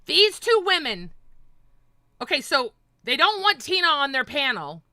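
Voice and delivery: normal talking voice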